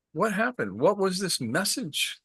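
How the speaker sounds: a lightly voiced, baffled merchant asking; bewildered merchant. Merchant asks the freed bird, lightly voiced puzzlement.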